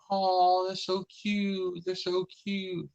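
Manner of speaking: high, drawn-out mock coo; cooing over cuteness. Crew seeing a picture of tribbles, cooing in high voices. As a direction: gush in a high, stretched-out voice.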